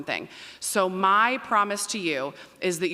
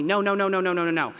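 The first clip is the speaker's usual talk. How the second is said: quick run of no's, a little louder; rapid-fire refusal; 3 semitones higher, 4 dB louder than usual. Retold or imitated. Parents telling their college student no, rapid-fire refusal. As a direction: mimic a parent refusing over and over.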